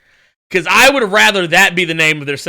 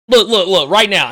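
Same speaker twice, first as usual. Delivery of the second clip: ordinary talk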